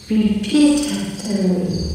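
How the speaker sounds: slow, flat "Repeat after me" like a robot; a stiff robot voice. Shown as a demonstration. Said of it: "I sound like a robot when I say that".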